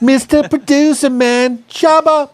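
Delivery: mock hype for the producer's nickname; announcer hype. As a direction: introduce him like a ring announcer. Hyping up the producer's name.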